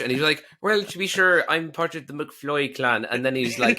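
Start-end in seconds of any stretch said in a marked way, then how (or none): 0.6-3.1 higher-starting, lilting mock Irish; put-on Irish accent. Actor showing he can do Irish, put-on Irish accent. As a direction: play up a stage Irish accent. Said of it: "Can you do Irish?".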